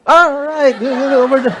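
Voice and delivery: a hint of a foreign tourist pointing the way; foreigner's reply. Foreigner answers him, pointing.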